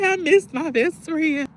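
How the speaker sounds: high, slow, whiny wail of missing a friend; high whiny longing. Retold or imitated. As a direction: whimper I miss my best friend like a pouting child. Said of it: "I be like".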